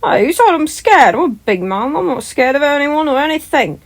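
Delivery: loud, high indignant boast; yelled. Acted. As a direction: shout it out, puffing your chest. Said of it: "Tommy yelled".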